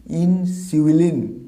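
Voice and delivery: ইনসুলিন as "in... siu-i-lin", drawn out; correct, syllable by syllable. Shown as a demonstration. Teacher repeating slowly for learners, ইনসুলিন as "in-siwi-lin". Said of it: "এর সঠিক উচ্চারণটা হবে".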